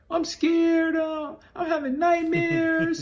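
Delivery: high, small frightened girl voice, imitated; mock scared girlfriend. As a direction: squeak it out like a scared girlfriend begging. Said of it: "She called me like".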